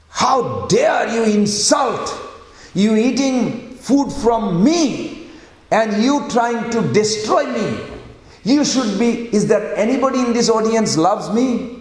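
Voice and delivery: high-pitched, drawn-out outrage; indignant declamation, words stretched out; angry. Acted. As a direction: act out an enraged king addressing his court. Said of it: "so angry with Vidura".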